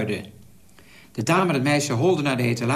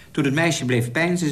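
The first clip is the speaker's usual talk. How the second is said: usual speaking voice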